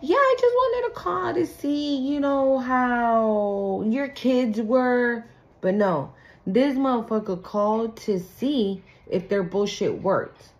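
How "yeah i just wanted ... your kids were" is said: high, slow syrupy fake concern; drawn-out put-on niceness; fake sweet call. Ex calling to check on kids, syrupy fake concern.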